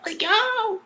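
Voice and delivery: long, very high awed exclamation; amazed reaction. Retold or imitated. Reacting to the one-hit takedown, amazed. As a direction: stretch it high in disbelief.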